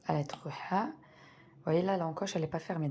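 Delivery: normal talking voice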